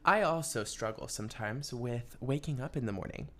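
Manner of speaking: ordinary talk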